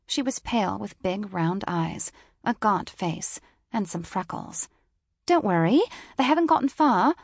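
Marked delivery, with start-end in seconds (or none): 5.2-7.1 British woman voice. A narrator's reading. Woman repeats reassurance louder.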